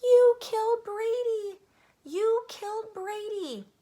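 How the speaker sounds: high, loud, drawn-out wail; yelling. A narrator's reading. Little boy blaming the dog for his toy, high yell. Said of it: "he yelled".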